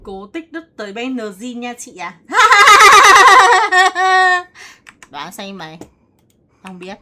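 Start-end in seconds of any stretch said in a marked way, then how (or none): 2.2-5.0 high, loud shrieking laughter. Real, not acted. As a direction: let out a wild shrieking laugh.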